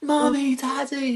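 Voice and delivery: put-on high, delighted squeal of a small boy; excited child. Seven-year-old son greeting parents at the door, high excited squeal. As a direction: squeal it like a kid thrilled to see parents.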